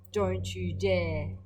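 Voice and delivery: slow, stern don't-you-dare; stern warning. Acted. Dad stopping Billy sniffing fence posts, slow stern warning. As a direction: drag it out as a firm warning.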